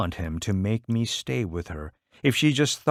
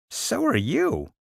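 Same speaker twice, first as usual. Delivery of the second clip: put-on higher, slow girlish taunt; teasing little girl; 13 semitones higher, slower than usual. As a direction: tease him like a seven-year-old sister. Caddy teasing her brother.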